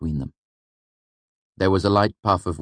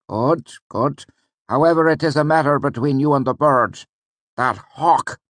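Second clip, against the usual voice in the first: much higher, put-on teacher voice; a higher character voice for the mentor; voiced old mentor Ben; 10 semitones higher, 5 dB louder than usual. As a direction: play the wry old teacher with mock gravity. Reads Ben's dialogue in a voice.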